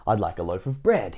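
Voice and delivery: ordinary delivery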